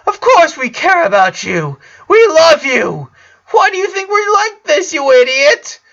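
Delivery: very high, loud, tearful shout; anguished yell. A narrator's reading. Sister shouting at her brother in the hospital, anguished. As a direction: cry out in hurt love. Sister yells she loves him.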